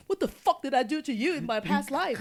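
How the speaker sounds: loud, high mock outrage; mom losing it. Mom hearing the partner is vegetarian, comically losing it. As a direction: explode like an exasperated mother, hammed up for laughs. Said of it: "She lost it".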